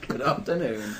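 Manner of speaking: laughing through the greeting. Real, not acted. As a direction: say it again while laughing.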